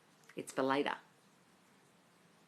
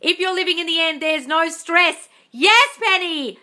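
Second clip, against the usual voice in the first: higher, louder, slow drawn-out praise; loud gushing; 9 semitones higher, 8 dB louder, slower than usual. Pretend. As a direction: stretch it out grandly. Gushing over viewers' comments.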